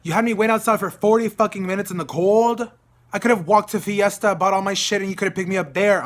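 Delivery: retold angry inner outburst; really upset. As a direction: rail at them over the wait. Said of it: "I'm getting really upset".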